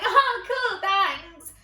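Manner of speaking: student thanking the lecturer in a hammed-up high squeak; awkward nervous thanks. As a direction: chirp it out, caught off guard. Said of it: "I was just like".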